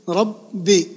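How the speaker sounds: ربي split as rab-bi; doubled ba. Shown as a demonstration. Teacher showing the B said twice, rab-bi for ربي. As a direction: hold the B in ربي, then release it. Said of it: "الباء نطقناها مرتين".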